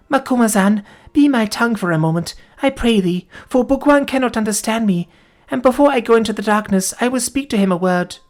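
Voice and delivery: put-on wounded girl telling what happened; dying young woman. A narrator's reading. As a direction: tell it as your strength ebbs. Voices the dying Folata.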